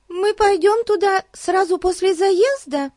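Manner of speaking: put-on high, eager little girl asking; girl's question. A narrator's reading. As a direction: ask it as a young girl. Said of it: "спросила она".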